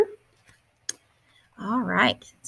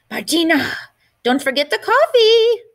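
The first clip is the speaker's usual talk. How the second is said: put-on much higher and louder old woman's call; 10 semitones higher, 6 dB louder than usual. Grandmother calling Martina to remember the coffee. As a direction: call down from the balcony.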